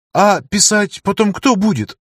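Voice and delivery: высоко и возмущённо, всплеснув руками; удивлённо, с упрёком; возмущённое удивление. Acted. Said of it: "сплеснула руками". (возмущённо, высоким голосом)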